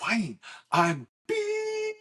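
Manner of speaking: high, drawn-out plea cut by a beep; frustrated call ending in a long high beep; retold frustration. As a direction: imitate a call cutting out mid-confession. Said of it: "I was so frustrated".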